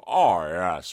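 low, lazy nodding-dog "oh yes"; deep, slow bulldog voice; Churchill dog impression. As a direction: drop low and drawl it like the insurance mascot. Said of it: "And also the Churchill dog".

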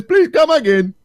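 put-on high, loud Indian accent; Apu's accent. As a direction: imitate a cartoon Indian shopkeeper's accent. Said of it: "has an accent and says".

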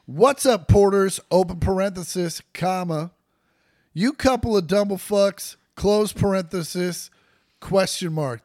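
reading out the punctuation aloud; dictating. Shown as a demonstration. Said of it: "those were all words".

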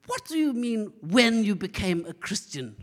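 indignant gran in emphatic British English; British accent. Retold or imitated. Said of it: "she speaks with this British accent".

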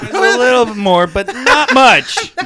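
loud, high, through laughter; real laughter bubbling through the words; laughing concession. Real, not acted. Player correcting the meagre recap, laughing.

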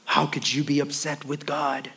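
low, soft, drawn-out shocked voice; hushed, scandalized whoa from the pews; mock scandalized churchgoer. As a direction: play the shocked congregant gasping at the pastor. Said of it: "some of y'all are like".

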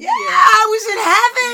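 high, loud burst of delight; elated shout. Real, not acted. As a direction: shout it out, blissed out. Exclaims over her happy moment.

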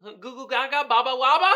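laughing, lightly voiced baby-talk creature; goofy creature gibberish, half laughing; mock creature babble. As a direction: babble like the movie monster through a laugh. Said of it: "heard the creature start talking".

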